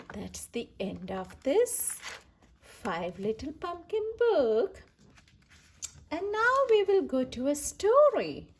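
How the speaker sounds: usual speaking voice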